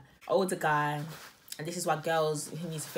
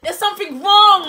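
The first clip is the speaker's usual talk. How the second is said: dramatic shout; 14 semitones higher, 17 dB louder than usual. Acted. Repeated, even higher.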